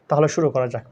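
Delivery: normal talking voice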